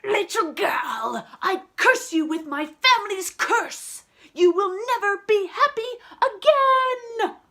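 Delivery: raging archduke curse; furious curse. Acted. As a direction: hurl the curse in rage.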